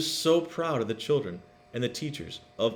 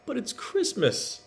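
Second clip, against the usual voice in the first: put-on high child's plea; high pleading little brother; brother calling; 11 semitones higher than usual. A narrator's reading. Little brother calls after Tilly, high and pleading. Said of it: "her brother called".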